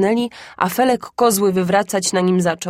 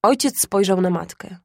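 usual speaking voice

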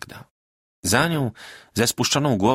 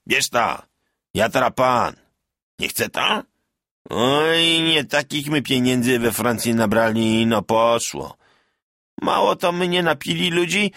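lightly voiced drunken bragging; tipsy boasting peasant; 6 semitones higher, slower than usual. A narrator's reading. Bartek treating the tavern crowd, drunk and boastful. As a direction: throw coins around grandly.

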